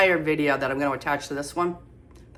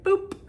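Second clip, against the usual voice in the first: boop. Real, not acted. Sound effect.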